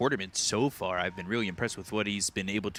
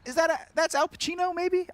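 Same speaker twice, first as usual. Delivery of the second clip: high squeaky question. Acted. Squeaky questioning after impression.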